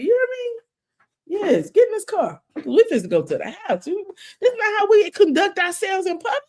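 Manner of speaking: a scolding mom ordering her kid home, played up; mock stern mother. Mother scolding her child again.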